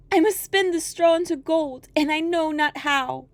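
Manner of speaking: put-on young girl's high wail; despairing maiden. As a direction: cry out your hopelessness. Maiden laments her fate.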